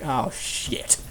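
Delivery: breathy whispered curse under the breath; whispered oh shit. Retold or imitated. Hiding in the truck, whispering the curse. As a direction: mutter it so nobody hears. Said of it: "And I was like".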